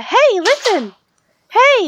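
high squeaky fairy call; Navi impression. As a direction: chirp it up high like an annoying fairy. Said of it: "Is it better than the".

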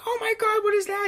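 high, frightened cry of alarm; scared girl. Retold or imitated. Girl in the Blair Witch woods, startled and frightened. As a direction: squeal it like a lost girl hearing something. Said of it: "and she's like".

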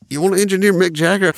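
a colleague's giddy question, imitated high; mock excited. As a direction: pitch it up like a thrilling offer. Clay's excited phone offer retold.

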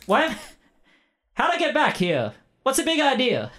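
high, startled indignant skeleton voice. Acted. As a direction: squeak it out, baffled and offended. Skeleton character wakes up.

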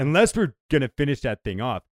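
high, loud comic objection; mock-outraged protest. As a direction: protest as if the request were preposterous. Husband protests opening the bottle.

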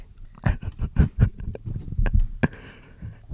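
long voiceless laugh into the mic; breathy, drawn-out mock ASMR laughing; whispered ASMR laughter. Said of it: "A S M R laughing".

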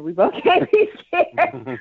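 high, slow, laughing plea; amused high-pitched laughing line. Real, not acted. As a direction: laugh through the words. Laughing while teasing Kevin.

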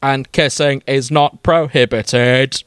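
slow robotic monotone; Dalek voice. Acted. Dalek rebuffs his human friend, slow mechanical monotone. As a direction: protest as a stiff Dalek. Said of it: "He replies in his delicate scream".

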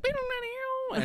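very high sung twang imitating a lyre; mock lyre twang. Host mimics baby Hermes strumming his lyre, high twangy mock notes. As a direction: pluck the strings with your voice. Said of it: "And then he's like".

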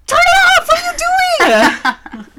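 high, loud mock screech; shrieked scolding from the next room; imitated yelling. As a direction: yell it like an alarmed mother. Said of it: "then I hear yelling".

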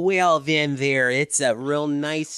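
slow, loud Midwest mom drawl. Acted. Co-host praises the network in Midwest mom voice. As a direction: draw it out like an approving Midwestern mom. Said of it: "Except for the Midwest mom".

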